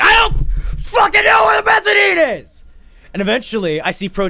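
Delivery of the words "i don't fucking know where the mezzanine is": high-pitched screamed outburst; exasperated scream. Retold or imitated. Lost man screams at his friend about the mezzanine, exasperated. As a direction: blow up at the caller, full volume.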